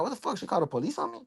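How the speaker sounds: high, hushed disbelief in his head; inner disbelief. Retold or imitated. Caught off guard by police, thinking in high, hushed shock. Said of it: "in my mind, I'm like".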